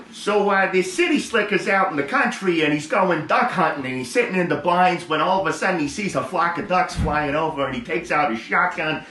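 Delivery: high-pitched comic storyteller; joke storytelling. Acted.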